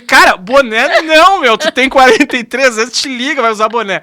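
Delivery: imitating a friend's scolding; teasing scold. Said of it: "mas eu notei que tu dizia".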